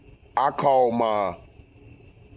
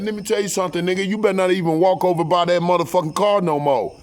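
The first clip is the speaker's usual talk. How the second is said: high, heated threat, building louder; angry warning; 8 semitones higher, 5 dB louder, faster than usual. Retold or imitated. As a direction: tell him off, retold with force. Warns man off his wife.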